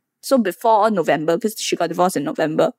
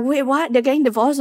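higher, startled double take; stunned disbelief; 6 semitones higher than usual. Retold or imitated. Netizens reacting to the divorce news, in disbelief. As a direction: sound like you can't believe it.

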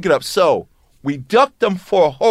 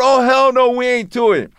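higher, louder indignant refusal; mock refusal; 6 semitones higher, 3 dB louder than usual. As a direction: protest it again, raised. Repeats refusal on phone.

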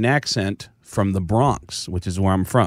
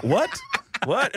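confused; 4 dB quieter than usual. Retold or imitated.

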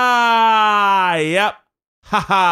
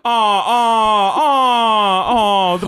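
mock crying; 4 semitones higher, 5 dB louder, much slower than usual. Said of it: "Let's all cry about goats".

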